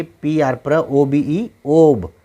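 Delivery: letters spelled slowly one by one; slow classroom spelling. Shown as a demonstration. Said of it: "लेकिन आगे देखिए".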